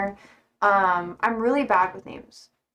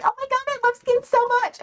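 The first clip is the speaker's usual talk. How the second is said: high, fast, squealing mock fangirl voice; gushing fangirl; 14 semitones higher, faster than usual. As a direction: parody a gushing teen fan. Mocking young US fans online.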